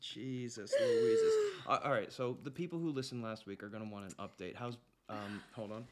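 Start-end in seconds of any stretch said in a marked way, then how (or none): 0.0-1.3 high, slow mock wail; drawn-out, squealing cry of dismay; exasperated exclamation.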